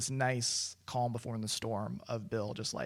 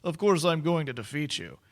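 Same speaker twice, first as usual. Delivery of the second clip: smarmy villain; 3 semitones higher, 4 dB louder than usual. Retold or imitated. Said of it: "a smarmy".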